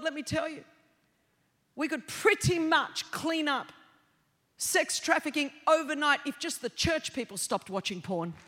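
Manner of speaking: usual speaking voice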